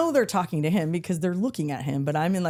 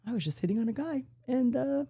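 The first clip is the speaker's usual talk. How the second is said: embarrassed guy; 7 semitones higher, 5 dB quieter than usual. Retold or imitated. Embarrassed guy quoted high.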